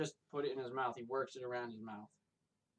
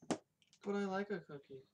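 talking around a dip of snuff; dip in mouth; 6 semitones higher, slower than usual. Real, not acted. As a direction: speak with tobacco tucked in lip. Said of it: "I still have dip in my mouth".

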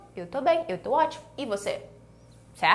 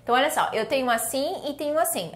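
ordinary delivery

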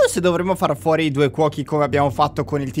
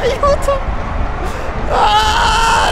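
panicked shout; 5 dB louder, much slower than usual. Real, not acted. Fleeing in panic.

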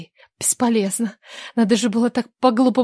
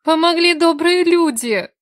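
higher, slow, tearful line; sobbing; 7 semitones higher, slower than usual. A narrator's reading. Vika to her ex-lover, tearful and slow. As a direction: choke it out through tears. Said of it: "всхлипнула она".

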